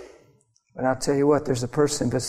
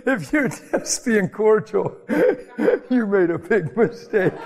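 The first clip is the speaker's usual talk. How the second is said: laughing; 7 semitones higher, slower than usual. Real, not acted. Laughing at the cordial seatmate story.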